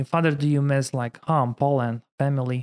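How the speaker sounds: ordinary delivery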